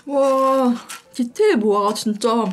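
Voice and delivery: talking while chewing; speaking between bites; mouth full of rusk. Real, not acted. Seen 3: Bites rusk at 0.6 and 5.0, chews while speaking throughout.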